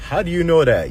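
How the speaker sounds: taken-aback reaction in a retelling. Retold or imitated. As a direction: act out being caught off guard. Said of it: "I'm taken back".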